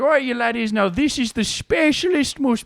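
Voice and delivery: loud high Irish brogue; mock Irish accent. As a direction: sell the potato in stage Irish. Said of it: "an Irish accent".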